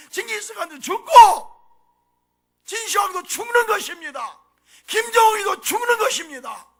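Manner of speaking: loud, high sermon cry; full-force preaching. Acted. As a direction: drive every point home from the pulpit. (힘차게 외치는 설교)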